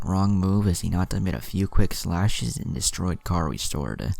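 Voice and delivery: ordinary delivery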